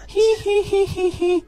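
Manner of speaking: high, loud, drawn-out ghost cackle; ghostly giggle. Retold or imitated. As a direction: giggle shrilly like a female ghost. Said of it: "ngetawain dia kenceng". (tawa hantu perempuan melengking)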